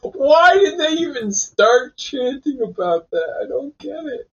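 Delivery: laughing. Real, not acted. Laughing at chant's origin.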